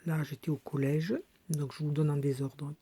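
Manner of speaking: ordinary talk, nothing marked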